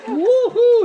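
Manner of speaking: high, loud woo-hoo; whooping priest. Retold or imitated. Priest whooping at the feast, loud and high. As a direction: cheer like a tipsy reveller. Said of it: "he went".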